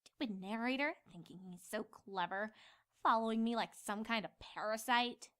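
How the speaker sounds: soft, low grumble; grumbling. Acted. Twilight grumbling about the narrator, soft and low. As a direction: mutter resentfully. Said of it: "Twilight grumbled".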